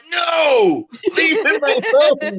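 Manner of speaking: high, loud mock protest; mock shout. Friend acting out a man's panicked protest, laughing. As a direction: yell it half-laughing like a panicked man. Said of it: "and he's like".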